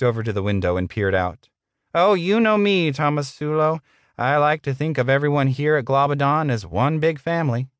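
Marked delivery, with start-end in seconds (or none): 1.9-7.7 high-pitched, falsely genial boss; oily corporate patter, pitched up; smarmy boss. Acted. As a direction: play the paternal manager in a put-on voice.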